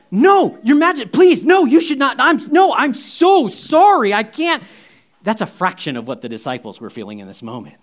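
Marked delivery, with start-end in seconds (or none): 0.1-4.6 loud, high-pitched, flustered apology; mortified protest. Retold or imitated. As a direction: plead in loud, hammed-up embarrassment. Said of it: "You would be mortified".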